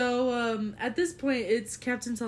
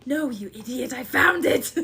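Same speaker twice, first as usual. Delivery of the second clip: mock snappish, louder, laughing; exasperated Harrington; 2 semitones higher, 9 dB louder than usual. Harrington snapping at the candy-obsessed player, mock annoyed. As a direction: scold the teammate with comic impatience. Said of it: "Harrington snaps at player".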